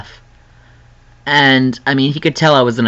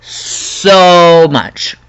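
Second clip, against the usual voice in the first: higher, louder gushing repeat; emphatic echo; 6 semitones higher, 5 dB louder than usual. Real, not acted. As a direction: gush the words a second time. Echoes own words, higher and louder.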